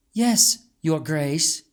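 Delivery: slow, bowing servant reply; deferential maid. A narrator's reading. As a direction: answer with a slight bow in the voice. Maid bows and replies.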